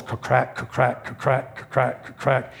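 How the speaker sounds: soft, rhythmic ka-crack sound effect; imitated cracking bones. Preacher tells crowd he heard bones crack, imitated cracking noises. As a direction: mimic bones popping one after another. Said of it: "And I heard bones".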